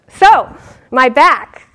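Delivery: slow, loud drawn-out "So, my back"; monk's complaint. Retold or imitated. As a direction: stretch it out like an endless complaint. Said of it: "this monk would go".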